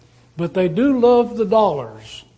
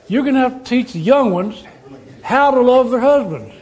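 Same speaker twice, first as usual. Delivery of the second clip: higher and louder, chuckling through the words; laughing; 6 semitones higher, 4 dB louder than usual. Real, not acted. Preacher teasing the older women about teaching wives, laughing.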